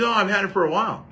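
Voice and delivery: high, quick, breezy dad voice; imitating dad, light and unbothered; dad's offhand reply. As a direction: toss it off like it's nothing. Voicing dad casually.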